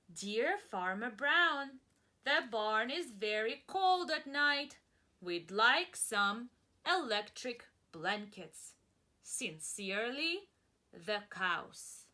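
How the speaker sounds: slow, loud formal letter read. Acted. Teacher reading the cows' letter to children, slow and formal. Reads letter aloud slowly.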